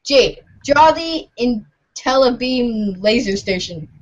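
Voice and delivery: ordinary delivery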